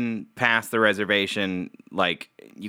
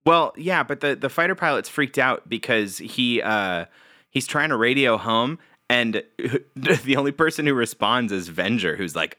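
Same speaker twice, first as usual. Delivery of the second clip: normal talking voice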